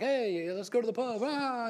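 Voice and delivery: high, hammed-up Irish lilt; a lightly voiced Irishman shouting to the pub; mock Irish pub call. An Irish customer inviting coworkers, mock Irish accent. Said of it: "And they're like".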